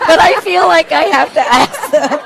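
giggling while getting the words out; laughing. Real, not acted. Moderator laughing at own joke.

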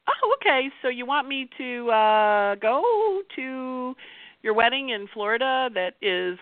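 high, sing-song oh, okay; slippery fake agreement. Slippery Mercury dodging a wedding invite, high sly agreement. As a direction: play along slyly, buying time. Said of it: "He's a little slippery".

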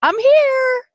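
high, loud romantic I'm here; a pretend hero arriving to sweep her off her feet; mock gentleman surprise. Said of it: "surprised me and said".

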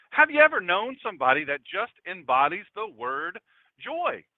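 ordinary delivery

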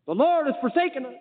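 high-pitched mock lament; put-on hopeless cry; acted desperation. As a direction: wail it a little, in pretended despair.

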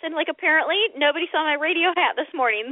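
usual speaking voice

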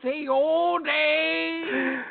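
slow, high British voice through fake teeth; high, slow British parody through buck teeth. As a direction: ham it up with big fake teeth. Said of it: "the fake terrible teeth".

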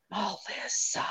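breathy unvoiced whisper of a name. Repeating the recorder's faint name, whispered.

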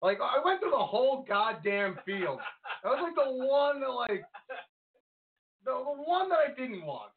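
high, wailing complaint; dismayed whine. Real, not acted. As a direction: moan about it in a high-pitched voice. Dismayed rant after his picks failed.

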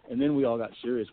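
ordinary talk

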